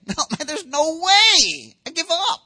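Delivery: high-pitched, stretched-out mock despair; exasperated protest. Believer told to match Abraham, comically exasperated. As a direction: throw up a loud, comic surrender. Acted discouraged protest at impossible standard.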